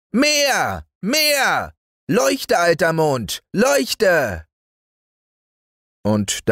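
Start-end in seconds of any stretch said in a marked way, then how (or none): 0.1-4.4 put-on high, bossy child yelling; demanding little boy. Häwelmann ordering the moon to shine, high bossy yell. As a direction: order the moon about like a spoiled small boy. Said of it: "schrie Häwelmann".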